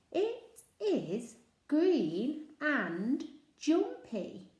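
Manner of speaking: each word drawn out slowly; slow, careful read to a child; slow reading aloud. Shown as a demonstration. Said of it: "teacher reads the clue slowly".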